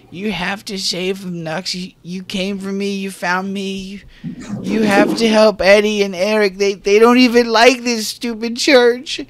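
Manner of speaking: put-on high, loud child's voice begging; a six-year-old pleading to save his brothers; high child's desperate plea.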